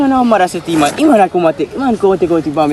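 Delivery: ordinary delivery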